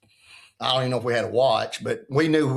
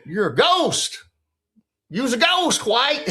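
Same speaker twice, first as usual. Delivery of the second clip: joking coach; 9 semitones higher, slower than usual. Retold or imitated. Coach's joking one-liner.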